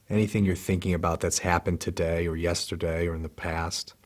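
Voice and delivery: soft, unhurried meditation voice with long pauses; calm, hushed body-scan guidance; guided meditation. Real, not acted. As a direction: guide them gently into relaxing, leaving space after each phrase.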